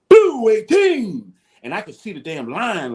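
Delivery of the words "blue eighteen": high, loud, slow snap count shout; shouted cadence. Retold or imitated. Quarterback shouts the cadence at the line, loud and drawn out. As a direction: bellow the count so the whole line hears. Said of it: "so everybody can hear you".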